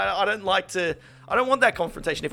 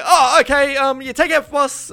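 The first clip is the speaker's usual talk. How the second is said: high, louder flustered politeness; high nervous fumbling; flustered mock reply; 11 semitones higher, 8 dB louder than usual. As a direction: pretend to scramble politely. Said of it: "I'm like".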